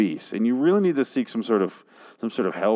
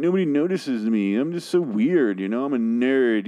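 higher sneering parody of a wannabe weirdo; mocking whiny voice; 4 semitones higher than usual. Mocking the band's lyric, higher and sneering. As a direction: mimic a self-pitying misfit. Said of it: "You say this in the song".

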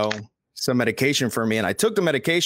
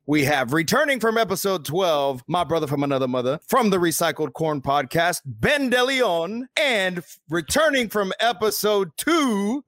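podcast host intro; 7 semitones higher than usual. Acted. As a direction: hype up the show like a host. Host opens podcast with music.

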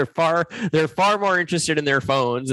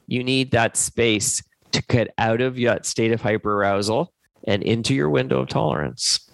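usual speaking voice

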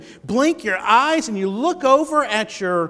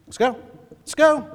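impatient hurry; 5 semitones higher than usual. Retold or imitated. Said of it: "always doing this".

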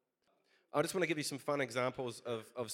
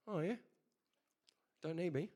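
knowing, teasing "oh yeah", pitched much higher; sarcastic retort; 8 semitones higher than usual. Retold or imitated. Jesus teasing the doubter about his leaks, sarcastic. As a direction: tease him, gently sarcastic, pointing at the mess. Jesus points at leaking sewage.

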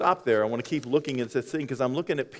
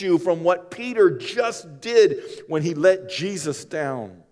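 slow, louder pulpit appeal; preaching; 5 semitones higher, 4 dB louder, slower than usual. Acted. Preacher urging the congregation, slow and louder. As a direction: drive each phrase home slowly.

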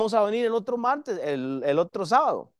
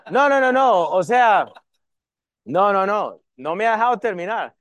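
loud, rushed no no no; playful loud protest, talking fast; mock protest; 2 semitones higher, 6 dB louder than usual. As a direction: cut in to stop the joke going further. Protests being cut off.

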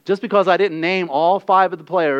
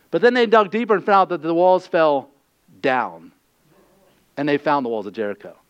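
ordinary talk, nothing marked

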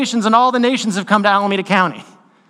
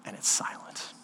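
1 whisper; 17 dB quieter than usual. Retold or imitated. Awkward silence in story.

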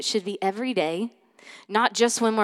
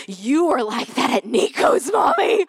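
put-on high, loud child's call-out; imitated accusing daughter; 3 semitones higher, 4 dB louder than usual. Daughter calling out her mom over Bible story, accusing. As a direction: rise into an accusing kid's cry.

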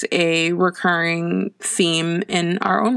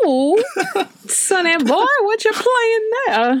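put-on old lady voice; slower than usual. Voicing old lady Ethel.

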